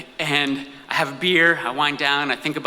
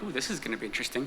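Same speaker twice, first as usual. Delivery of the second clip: quiet aside; 3 semitones lower, 11 dB quieter, faster than usual. Retold or imitated. Inner thought aside.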